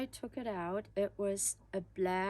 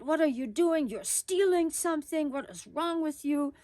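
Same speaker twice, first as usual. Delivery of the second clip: high, loud accusing outburst; upset aunt; 7 semitones higher, 6 dB louder than usual. Retold or imitated. Aunt catches niece with the cape, upset loud scolding. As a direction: scold her as a thief, louder and higher. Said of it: "she got very upset".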